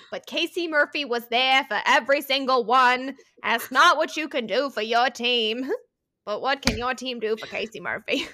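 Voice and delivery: loud, emphatic stump-speech delivery; political speechmaker. Acted. Host rallying for Casey Murphy, mock political oratory. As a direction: declaim it like a politician at a podium. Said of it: "the political thumb thing right now".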